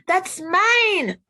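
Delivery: put-on high, loud child's yell. Josh yelling at the boy over the truck, high childish yell. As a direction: snap it out like a kid grabbing a toy. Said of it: "Josh yelled".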